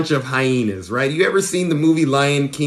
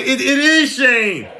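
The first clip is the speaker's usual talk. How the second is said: indignant; 11 semitones higher, 3 dB louder than usual. Real, not acted. Indignant vlogger rant.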